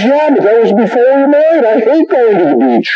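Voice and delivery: very high-pitched mock retort; retorting wife. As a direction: imitate an exasperated wife answering back, high voice. Wife retorts in acted marriage quarrel.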